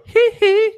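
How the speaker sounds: falsetto "hee hee" squeal; high-pitched imitated "hee hee". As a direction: squeal a tiny, very high pop-star yelp. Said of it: "He's [name] at that point".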